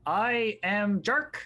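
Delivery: grand proclamation. Acted. Fighter introducing himself to a watching crowd, grandly proclaiming. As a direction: proclaim each title with slow pomp.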